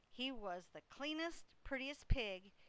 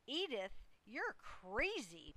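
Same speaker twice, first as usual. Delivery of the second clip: plain reading voice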